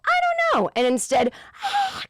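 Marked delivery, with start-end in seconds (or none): 0.0-0.7 very high-pitched exclamation; intended high voice. Shown as a demonstration. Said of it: "If you try to go". 1.6-2.0 high, strangled croak of a lost voice; failed voice. Shown as a demonstration. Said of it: "comes out".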